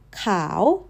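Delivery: khao dips low then lifts like a question; low start rising at the end. Shown as a demonstration.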